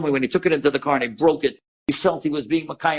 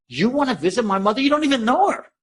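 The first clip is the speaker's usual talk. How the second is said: rising, high-pitched retold disbelief; astonished; 7 semitones higher than usual. Son stunned that a stranger would visit his mother, astonished. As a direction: imitate a man floored by the offer. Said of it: "The guy almost fell out of the car".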